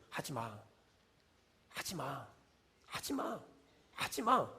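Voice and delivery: very soft, spaced-out warning; hushed prohibition. Acted. God telling complainers to stop, acted hushed warning. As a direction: repeat it quietly with grave restraint.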